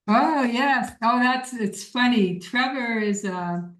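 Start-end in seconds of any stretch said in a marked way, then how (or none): none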